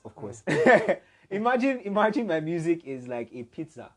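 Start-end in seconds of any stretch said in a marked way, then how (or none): 1.3-3.9 high, bright West African accent impression. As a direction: repeat it in a strong African accent. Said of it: "in his very African voice".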